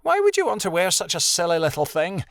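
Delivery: high, scornful character voice; disdainful high-voiced question; snarling. A narrator's reading. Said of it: "Rebecca snarled".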